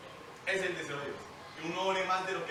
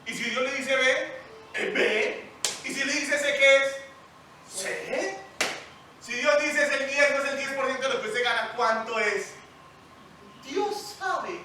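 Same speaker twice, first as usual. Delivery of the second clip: louder, higher insistent sermon voice; fiery preaching; 6 semitones higher, 6 dB louder than usual. Acted. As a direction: drive the point home to the congregation. Preaching at full force. (predicación con fuerza)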